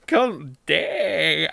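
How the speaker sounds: ordinary talk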